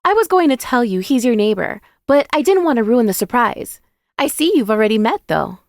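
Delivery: put-on high, loud, girlish character voice; high-pitched excited friend; apologetic, bubbly best friend. A narrator's reading. Narrator voices Jada explaining herself.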